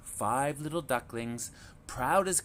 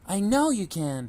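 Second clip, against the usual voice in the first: high, louder reassurance; encouraging mother duck; 9 semitones higher, 7 dB louder than usual. A narrator's reading. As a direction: cheer the little ones on. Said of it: "Mama said".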